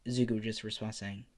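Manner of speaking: ordinary delivery, nothing marked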